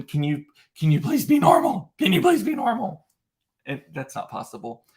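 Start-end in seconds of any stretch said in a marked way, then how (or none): 0.0-3.0 put-on embarrassed kid's whiny plea, rising higher; pleading kid. Kids begging embarrassing dad in Target, whiny plea. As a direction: beg like a mortified teen. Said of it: "the kids at this point are just like".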